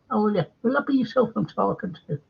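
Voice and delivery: high, quick put-on Irish accent. Host reading Sean's comment, high Irish accent. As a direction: read it as an imitation Irishman. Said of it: "Wonder if that's in an Irish accent".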